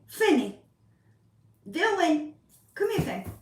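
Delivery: high call to the cats. Real, not acted. Owner calling her cats over, high call.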